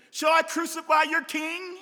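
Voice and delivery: high, ringing shouted question; Pilate's loud challenge. Acted. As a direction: call it out loudly to an angry crowd. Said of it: "Pilate said to them".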